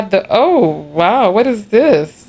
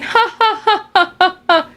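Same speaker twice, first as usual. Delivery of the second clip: high, bubbling laughter as she retells it; laughing; 11 semitones higher than usual. Real, not acted. Laughing off the stranger's remark in the hot tub. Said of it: "all I did was just laugh".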